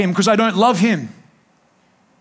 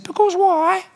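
put-on childish why; 8 semitones higher, slower than usual. Young Jesus voiced as child.

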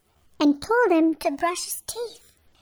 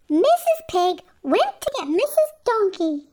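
plain reading voice

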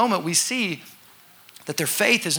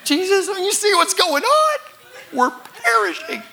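high, frantic mock panic; hammed-up frightened cry to a sleeping Jesus; panicked whine; 13 semitones higher, 3 dB louder than usual. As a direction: plead like the boat is sinking.

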